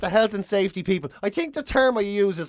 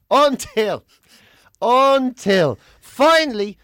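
each until louder, drawn out with pauses; dramatic build-up; 3 semitones higher, 6 dB louder, much slower than usual. Acted. Host teasing his hero of the week, building the suspense. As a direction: stretch the suspense before the reveal.

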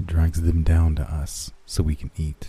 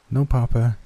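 put-on child voice; 6 semitones higher than usual. Child answers her father.

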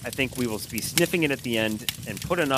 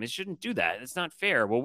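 ordinary talk, nothing marked

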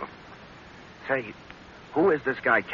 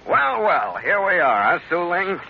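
sly greeting; 9 semitones higher, 4 dB louder, slower than usual. Acted. Sly greeting alone with her.

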